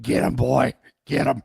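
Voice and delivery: sic the dog on them, loudly; hearty shout to the dog; egging on the dog. Retold or imitated. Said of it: "I'm like".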